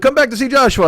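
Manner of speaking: put-on high, eager accented old deli man; high eager voice of the old deli owner pushing a huge sandwich. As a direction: gush like a proud old shopkeeper. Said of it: "he's like".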